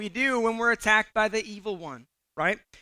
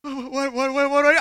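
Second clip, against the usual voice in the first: higher, stuttering mock panic; acted-out frightened babble; petrified stammer; 6 semitones higher, 4 dB louder than usual. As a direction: freeze up and stammer, building louder. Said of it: "I'm, like, petrified".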